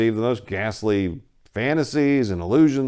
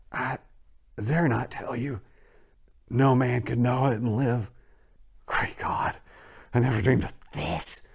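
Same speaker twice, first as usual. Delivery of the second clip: hoarse with fear; 4 semitones higher than usual. A narrator's reading. Warren refusing to tell Carter, hoarse fearful whisper. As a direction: whisper the horror, hopeless. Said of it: "still hoarse with fear, and now apparently tinged with despair".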